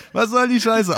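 very high, loud startled yell; outraged shriek. Acted. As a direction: shriek at the flying urine. Acted angry visitor hit.

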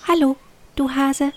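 viel höhere, kleine Elfenstimme. A narrator's reading. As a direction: wie eine winzige Elfe auf der Nasenspitze. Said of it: "flatterte auf Hugos Nasenspitze und sagte". (die kleine Elfe begrüßt den Hasen mit hoher Stimme)

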